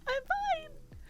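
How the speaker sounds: a teary, rising, unconvincing I'm fine; strained i'm fine. Real, not acted. Crying, insisting she's fine.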